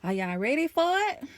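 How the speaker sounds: a high, loud hype call; hyped-up question. Real, not acted. Vlogger to her viewers, hyped and high and loud. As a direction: pump up the audience. Hyping up viewers before the story.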